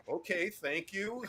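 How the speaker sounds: soft, slow, uneasy brush-off; awkward quiet reply. Retold or imitated. To the naked stranger, soft and awkward. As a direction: back away politely, barely audible. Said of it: "And I'm like".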